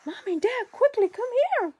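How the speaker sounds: high, loud urgent cub voice; Baby Bear calling. Acted. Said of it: "Baby Bear goes to his bed and says".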